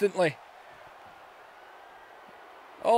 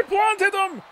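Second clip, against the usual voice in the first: excited shout; 11 semitones higher than usual. Real, not acted. Commentator reacting to the slam, sudden high shout. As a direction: yell it as the slam lands.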